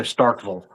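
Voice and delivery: "Starkville" with the ending reduced to "vul"; local Starkville. Retold or imitated. Mimicking how locals name the town, reduced "Starkville" ending. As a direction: swallow the last vowel of "Starkville". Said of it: "They go".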